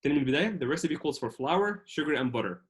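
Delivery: ordinary delivery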